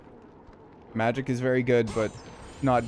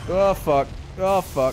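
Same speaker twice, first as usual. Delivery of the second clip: panicking gamer; 6 semitones higher, 4 dB louder, slower than usual. Real, not acted. In-game danger, swearing.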